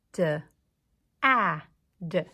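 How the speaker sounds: sounds said apart, duh ah duh; each sound duh, ah, duh separate with gaps; bumpy sounds. Shown as a demonstration. Teacher shows class the bumpy way, choppy separate sounds. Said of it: "Let's do that one more time".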